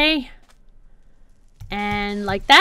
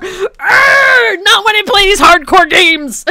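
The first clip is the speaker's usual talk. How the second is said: growled outburst into high, loud shouting; frustrated yell; 8 semitones higher, 8 dB louder, slower than usual. Real, not acted. Gamer raging at these hardcore games, yelling. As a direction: blow up at the game, shouting. Exploding over hardcore game.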